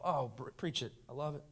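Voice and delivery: usual speaking voice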